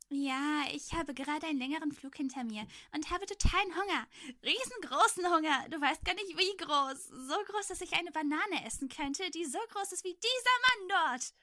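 put-on high, loud, gushing little boy; excited boy. A narrator's reading. Voicing the excited hungry boy.

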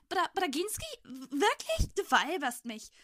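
high, loud stammering disbelief; startled boy. A narrator's reading. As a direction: stammer it in shocked amazement. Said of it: "Sofort fuhr Grzegorz in sich zusammen".

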